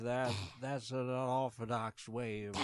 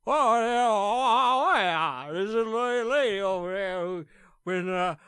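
excited; 7 semitones higher, 12 dB louder than usual. Acted. Excited spotting through binoculars.

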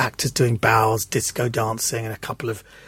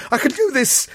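much higher, desperate insistence; strained insistence; 11 semitones higher than usual. Retold or imitated. Failing to lift her, high desperate insistence. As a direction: squeak out a stubborn promise.

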